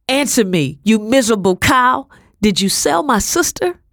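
high, loud furious yelling; furious shout. Acted. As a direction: scream it at her in rage. Furious confrontation with mistress.

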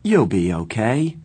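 firm, insistent reassurance. A narrator's reading. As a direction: hit the first word hard. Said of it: "I repeated firmly".

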